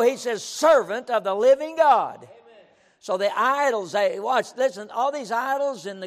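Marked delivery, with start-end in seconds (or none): 0.4-2.3 calling out. Acted. The king's true cry, corrected.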